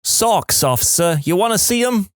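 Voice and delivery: mock Jersey comeback; fake Jersey accent. A narrator's reading. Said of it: "In my fakest Jersey accent".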